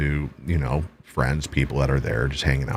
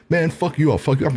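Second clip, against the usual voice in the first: higher-pitched imitation of a little tough guy; mock threat; 9 semitones higher, faster than usual. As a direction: mimic an opponent's cocky threat. Mimics the small man's threats.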